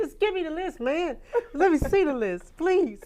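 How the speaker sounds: loud, high, begging for the list; mock pleading. Singer begging rivals for the list, loud pleading.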